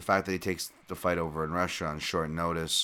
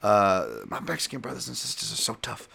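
Don King impression; 6 semitones higher than usual. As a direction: preach it like a fight-night hype man to his fans. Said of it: "As Don King would say".

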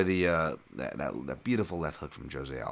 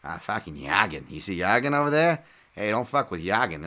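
loud Boston accent, Yorgan squeezed to "Yagan"; 6 dB louder than usual. Shown as a demonstration. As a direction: bark it like a tough Boston local guarding his guy. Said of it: "you almost have to say it with a Bo-, Boston accent".

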